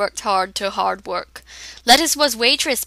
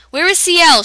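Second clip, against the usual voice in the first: higher, louder demand; angry; 9 semitones higher, 7 dB louder than usual. A narrator's reading. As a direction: narrate an angry, urgent question. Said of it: "she said, angry".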